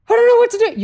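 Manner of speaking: very high, loud helpless squeal; hammed-up panicking dog owner; mock panic.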